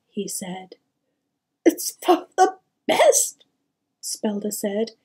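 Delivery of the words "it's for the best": high, strained weeping voice; grief-stricken mother; tearful. A narrator's reading. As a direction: say it bravely through sobs. Weeping mother sends son away.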